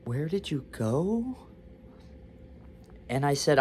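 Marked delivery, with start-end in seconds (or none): 0.0-1.5 soft, high, slow hesitant question; drawn-out, timid mock voice, rising at the end; nervous. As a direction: imitate a nervous receptionist asking gingerly. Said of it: "And she was nervous".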